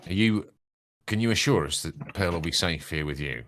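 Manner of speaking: ordinary talk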